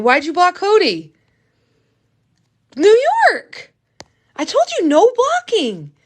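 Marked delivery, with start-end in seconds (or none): 2.8-5.9 high, loud exasperated call of his name; scolding call. Real, not acted. Streamer scolding fan New York over blocking people, loud and exasperated. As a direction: call him out sharply, like a parent.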